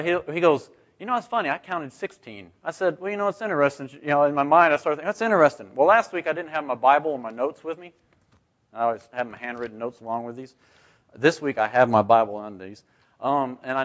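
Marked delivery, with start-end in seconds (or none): none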